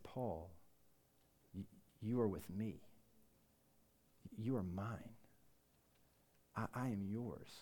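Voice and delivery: soft, unhurried divine assurance; hushed, solemn words of belonging; tender reassurance. Acted. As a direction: speak gently, with long pauses. Voicing God's tender address to him.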